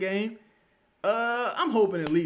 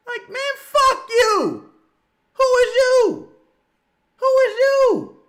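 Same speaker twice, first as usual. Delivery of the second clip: much higher, loud, slow shout; mock shout; 10 dB louder, much slower than usual. Speaker yelling back at Jake in a retold bar scene, loud high mock shout. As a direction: bark it back at him, high and outraged. Retorting to Jake's advice.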